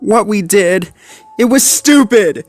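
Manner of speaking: high, loud, guilt-stricken pup; remorseful cry. A narrator's reading. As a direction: plead for forgiveness. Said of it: "Rubble sniffed".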